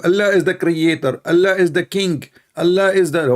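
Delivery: high, loud, overexcited mock chant; mock excited preacher. Mocking the answers Muslims give, loud shout. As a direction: ham up a frantic preacher listing titles. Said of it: "this is the answer they will give you".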